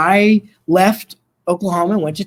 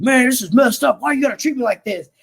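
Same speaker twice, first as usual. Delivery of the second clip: higher, put-on griping of an inmate pretending to be mad; mock angry grumble; 8 semitones higher than usual. Inmate fakes anger at the officer for show, mock grumbling. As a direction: fake a sulky complaint so the others believe it. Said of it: "he starts mumbling and getting angry".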